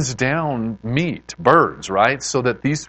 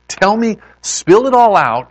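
raised, higher insistent plea; acted parental exhortation; urging parent; 8 semitones higher, 7 dB louder than usual. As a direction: press your child to open up, louder. Parent urging upset child to talk.

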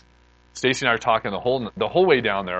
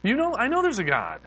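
high-pitched, amazed disbelief; retold astonished marvelling; freaking out; 10 semitones higher than usual. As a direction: reenact being stunned, voice way up high. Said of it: "I'm freaking out, just going".

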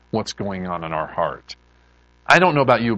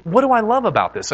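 normal talking voice

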